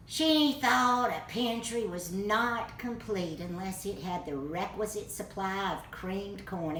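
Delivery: usual speaking voice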